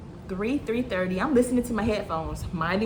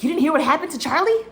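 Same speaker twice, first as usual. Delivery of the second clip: higher, louder, quick mock-astonished question; fast, raised-pitch retold disbelief; excited gossip; 6 semitones higher, 5 dB louder, faster than usual. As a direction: imitate a boss bursting with news. Boss bursts in with gossip.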